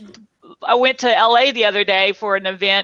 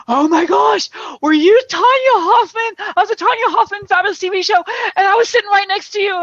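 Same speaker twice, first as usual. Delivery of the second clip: high, fast gushing message; excited voicemail; 8 semitones higher than usual. Retold or imitated. Woman leaving an excited voicemail, high and fast. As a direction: gush into a voicemail. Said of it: "on my voicemail ... is like".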